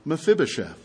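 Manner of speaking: soft, gentle, kindly said name; tender calling of a name. Shown as a demonstration. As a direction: say his name with quiet kindness. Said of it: "there was tenderness and kindness in David's voice".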